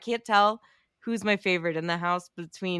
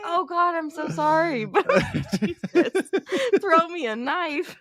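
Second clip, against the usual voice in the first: higher, laughing through the sorry; laughing mock apology; 10 semitones higher, 3 dB louder than usual. Jonah to Jay after the knife, a high laughing apology. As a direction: apologize while cracking up. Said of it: "He's like".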